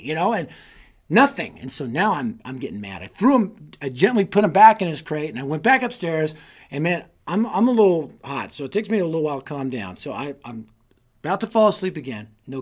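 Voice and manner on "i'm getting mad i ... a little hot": usual speaking voice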